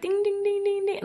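high, rapid-fire dinging; imitated alarm bell. Speaker imitating her awareness going off, high alarm dinging. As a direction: ring it out like a little alert bell. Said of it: "my awareness was like".

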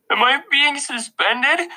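high, slow tearful question; sniffling. A narrator's reading. Boy to principal after crying, sniffling. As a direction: ask slowly through sniffles. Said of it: "I sniffled".

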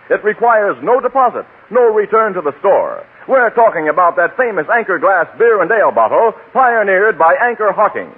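ordinary talk

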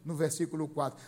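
ordinary talk

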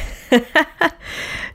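high giggle breaking into the word; burst of laughter. Real, not acted. Recalling the funny scene, a high laugh.

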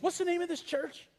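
high, curious question from friend; mock puzzled question. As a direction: ask it as if truly baffled. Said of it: "he goes".

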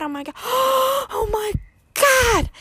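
gasping, high-pitched panic; panicked gasping cry. Retold or imitated. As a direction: gasp it out in terror. Panics, gasps mid-line.

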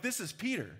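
ordinary talk, nothing marked

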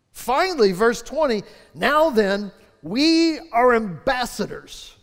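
loud, rising preacher's build; preaching. Acted. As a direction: preach it out with full force. Preacher rises to full force.

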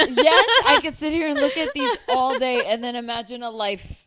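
laughing. Real, not acted. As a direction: laugh while you rave about it. Gushing over screensavers while laughing.

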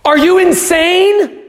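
loud, slow, high-pitched disbelief; incredulous outburst. Acted. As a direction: hurl the question at them, slowly and loudly. Preacher rebukes the congregation.

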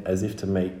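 usual speaking voice

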